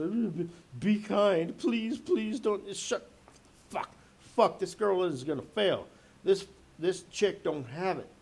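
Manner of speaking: high, slow, pleading inner voice; a pleading girl's voice that turns to despair; panicked girl's inner voice. Retold or imitated. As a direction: voice her silent panic, begging and then despairing. Voicing the girl's panicked thoughts.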